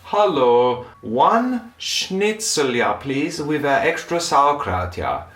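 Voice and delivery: put-on German accent, slow on schnitzel; mock German accent. German family ordering schnitzel in Spain, German accent. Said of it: "German family be like".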